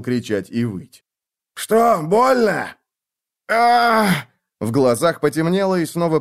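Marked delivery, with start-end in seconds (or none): 1.5-2.8 put-on slow, high gloating old man; mocking old man. Old archer mocks wounded man. 3.5-4.3 pained gasp. Acted. Gasp of pain.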